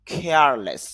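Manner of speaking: কেয়ারলেস with a breathy kh at the start; কেয়ারলেস said khearless, air bursting out; aspirated careless. Shown as a demonstration. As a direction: push extra air on the k of কেয়ারলেস. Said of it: "কেয়ারলেস না বলে উচ্চারণটা হবে".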